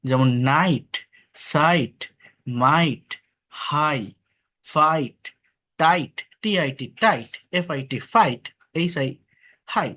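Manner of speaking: নাইট, ফাইট said with silent gh, আই clear; teacher word drill. Shown as a demonstration. Teacher drilling igh words for students. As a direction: model each word, gh silent. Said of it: "G H টা silent থাকে".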